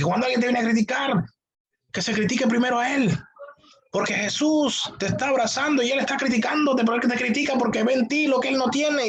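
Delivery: high-pitched mock preacher rant; imitating shouting preacher. Pastor mocking a viral preacher to his congregation, imitated shouting. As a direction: parody a hype preacher firing off slogans. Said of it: "dice un poco de cosas, gritando".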